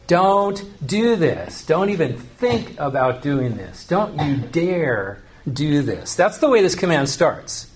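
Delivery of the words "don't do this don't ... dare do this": slow, weighted DON'T, then a pause on each warning; deliberate, spaced-out "Don't you dare do this"; emphatic no. Shown as a demonstration. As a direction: forbid it firmly, stretching "Don't" and "dare". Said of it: "This is an emphatic no".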